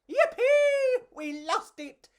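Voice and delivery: high, loud squeal of joy; joyful cockroach cheer. Acted. Pedro celebrating escape from the gecko, high joyful squeal. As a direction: whoop like a tiny bug who escaped. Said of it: "Pedro said".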